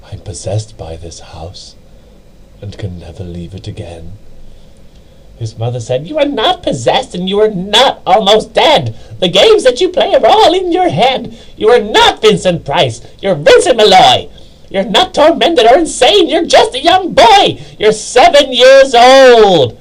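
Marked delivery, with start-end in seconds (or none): 0.1-4.1 put-on low, soft, hollow boy voice; softly voiced boy's eerie confession; gloomy boy's note. 6.1-19.8 much higher, louder scolding mother; furious high mother's rant; angry mother. Acted. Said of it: "Her anger now spent".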